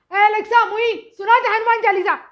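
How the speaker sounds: loud, high scolding order; rough bossy call. Retold or imitated. Mother orders Alexa, loud and rude. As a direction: bark it at the device. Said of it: "थोड़ा आराम से बोलो, स्पष्ट बोलो ना".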